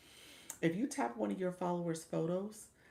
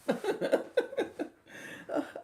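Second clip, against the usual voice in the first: airy laugh jumping way up; real high giggle; high squealing laugh; 5 dB louder than usual. Real, not acted. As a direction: squeal with delight. Laughing at own story.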